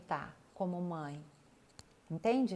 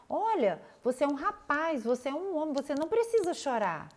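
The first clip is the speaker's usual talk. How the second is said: mock scolding lecture; 6 semitones higher, 4 dB louder than usual. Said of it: "eu escuto direto".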